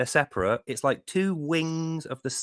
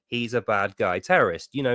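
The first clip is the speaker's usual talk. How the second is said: ordinary talk, nothing marked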